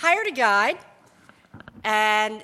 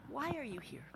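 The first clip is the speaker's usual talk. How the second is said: much softer, even and unhurried; calm question; 17 dB quieter, much faster than usual. Retold or imitated. Jane Goodall questioning the eager lawyer, calm and soft. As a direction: ask it calmly, unimpressed. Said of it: "took that in calmly".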